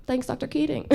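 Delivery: sweetly sarcastic; note to the psychiatrist, sarcastic; mock thank-you note. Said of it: "write a suicide note, like".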